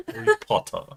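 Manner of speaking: high-pitched British mimicry; teasing British POT-tah; mock British accent. Mimics her British pronunciation again.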